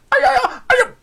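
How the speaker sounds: very high, loud yelp of pain; pained cry. A narrator's reading. Executioner yelps as a throwing knife hits his hand, pained cry. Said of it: "那么惨叫一声".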